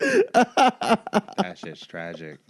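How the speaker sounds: real laugh carrying the words. Real, not acted. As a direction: stretch it out while cracking up. Reacting to the joke, laughing.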